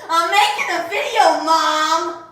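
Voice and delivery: loud laughing call to mom. Real, not acted. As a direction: shout it across the house, laughing. Calling out to mom off-camera.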